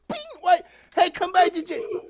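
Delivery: ordinary delivery, nothing marked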